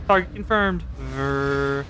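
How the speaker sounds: usual speaking voice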